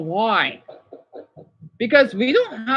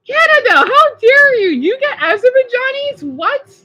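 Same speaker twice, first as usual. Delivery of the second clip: much higher and louder playful indignation; mock outrage; 13 semitones higher, 6 dB louder than usual. Real, not acted. Vlogger teasing Canada about Azerbaijanis, high mock outrage. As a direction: scold the country in jest.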